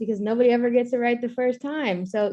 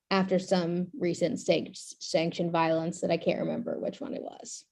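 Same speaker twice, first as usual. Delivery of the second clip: ordinary delivery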